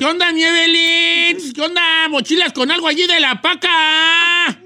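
Mexico City street vendor voice; chilango accent. Retold or imitated. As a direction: hawk it like a chilango. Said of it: "Le sale re bien, es hasta chilangazo".

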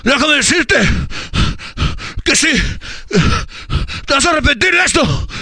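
trash-talk like a pro wrestler on the mic. Retold or imitated. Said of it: "imagínate la voz del luchador".

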